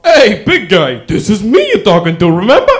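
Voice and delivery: lightly voiced cocky old man; mock bragging grandfather. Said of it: "to say".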